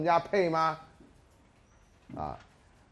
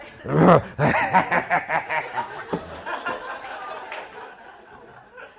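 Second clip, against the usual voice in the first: long laughing fit; 3 semitones higher, 6 dB louder than usual. Real, not acted.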